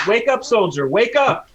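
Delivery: commanding shout to wake someone; rousing a soldier. Acted. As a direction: urgently rouse a sleeping soldier, loud and high. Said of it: "I just smack him, just like".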